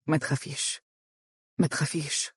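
very soft, hushed reassurance; tender. A narrator's reading. Husband holding his frightened wife close, tender and hushed. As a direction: soothe her gently, almost under the breath. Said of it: "قائلًا بحنان".

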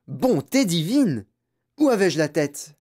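slow, high, drawn-out dismay; astonished exclamation. Acted. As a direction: exclaim as if catching your own blunder. Grandfather exclaims at his forgetfulness.

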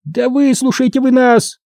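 high-pitched, mildly barked demand; insistent high gnome voice. A narrator's reading. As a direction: bark it high, as a little gnome. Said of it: "рявкнул Уэсли".

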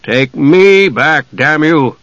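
slow, forceful demand; heated, insistent order. A narrator's reading. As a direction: demand it angrily, stretching the words. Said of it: "Caramon demanded".

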